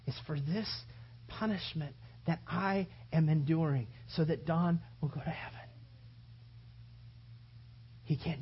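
Jesus quietly choosing each lash for us; tender inner voice of Jesus. Acted.

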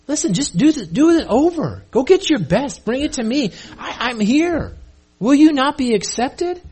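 high-pitched, urgent appeal to a wayward listener; earnest pleading. Retold or imitated. God pleading with Cain.

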